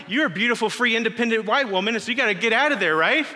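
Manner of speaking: a slightly hammed-up parody of self-help talk; mocking the world. Preacher mimics the world's selfish advice to the congregation, mock-preachy. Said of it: "the world proclaims".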